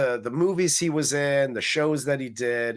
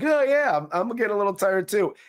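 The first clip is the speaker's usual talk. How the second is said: put-on high, quick kid's voice; mock kid; 6 semitones higher, 3 dB louder, faster than usual. Kid echoing the tired dad, high and quick. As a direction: squeak it out like an eager little kid. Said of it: "the kid's like".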